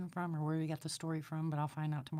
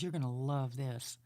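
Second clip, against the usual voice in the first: lower, knowing male voice, lightly done; lightly voiced realtor; 4 semitones lower than usual. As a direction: drop into a sly knowing voice. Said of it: "He goes".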